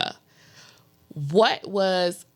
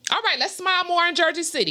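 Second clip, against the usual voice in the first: playful quip; 11 semitones higher, much faster than usual. Real, not acted. Joking resolution after answer.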